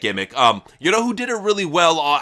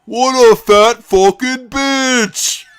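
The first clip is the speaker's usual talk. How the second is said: loud, slow, high jeer; mock roast shout; 8 semitones higher, 8 dB louder, much slower than usual. Don Callis jeers at Tommy in a loud, slow, high jeer. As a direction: jeer at him with relish.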